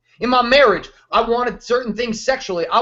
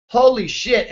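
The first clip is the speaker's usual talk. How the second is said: ordinary talk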